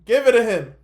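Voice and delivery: imitating mom's loud holler; mom yelling back. Mom yells back from background.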